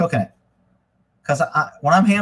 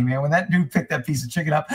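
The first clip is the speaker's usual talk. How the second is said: ordinary delivery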